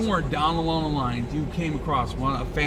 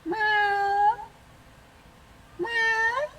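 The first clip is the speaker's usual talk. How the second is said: imitated meow. Meowing at a cat.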